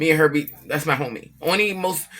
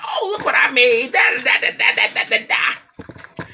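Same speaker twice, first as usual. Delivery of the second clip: mock bragging; 9 semitones higher, 3 dB louder, slower than usual. Mocking a bragging Facebook post.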